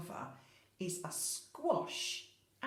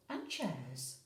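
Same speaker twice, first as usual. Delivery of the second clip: plain reading voice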